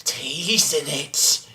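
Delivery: low, very soft, suggestive murmur; creepy mock teasing. Frodo fingering the ring before Sam, mock creepy. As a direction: draw it out low and quiet, creepily savoring it.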